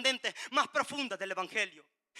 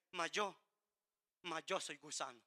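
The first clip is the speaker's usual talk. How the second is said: hushed lament; 8 semitones lower, 13 dB quieter than usual. Acted. Jesus's cry from the cross, quoted.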